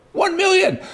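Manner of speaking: shocked exclamation. Real, not acted. Telling viewers about a million-dollar tax, in disbelief. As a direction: gasp it out like the bill just landed.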